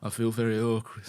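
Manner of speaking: put-on Jon Snow northern accent; lightly imitated northern accent. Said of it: "merged into my Jon Snow impression".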